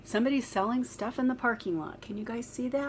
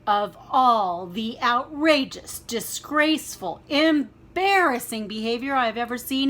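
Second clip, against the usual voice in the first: angry roar; 2 semitones higher, 6 dB louder than usual. Acted. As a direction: roar out each word in disgust. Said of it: "he roared".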